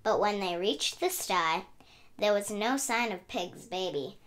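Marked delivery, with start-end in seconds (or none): none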